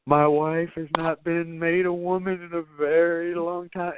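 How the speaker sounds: high, slow séance voice; hammy medium channelling a husband; fake dead husband. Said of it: "trying to act like her husband".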